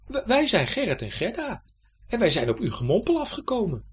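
high, loud youngster explaining; child's voice. A narrator's reading. Children introduce themselves to the knight, high and loud. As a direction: pipe up to a strange grown-up.

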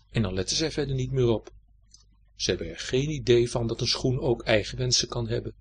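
ordinary delivery, nothing marked

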